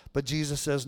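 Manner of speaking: ordinary talk, nothing marked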